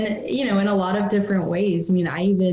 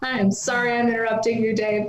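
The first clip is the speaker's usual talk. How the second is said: higher-pitched, lightly acted apology; mock apologetic caller; 5 semitones higher than usual. Caller apologizing to a pizza place for interrupting, mock apologetic. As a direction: play an overly sorry phone customer.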